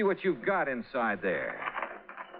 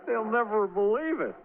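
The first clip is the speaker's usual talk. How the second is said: higher, laughing through the words; laughing at the laundered money, higher; laughing disbelief; 9 semitones higher than usual. Acted. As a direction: chuckle it out in amazement. Laughing at absurd discovery.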